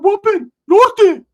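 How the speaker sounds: high, loud, slow gang shout; Ricky's callout. Retold or imitated. Ricky answering the Southsiders with a high, loud, slow call. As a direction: drag out the call proudly. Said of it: "Ricky's like".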